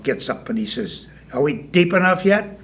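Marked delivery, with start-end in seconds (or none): 1.3-2.6 higher, questioning call; mildly raised bullfrog voice. Acted. Bullfrog's first call from hole.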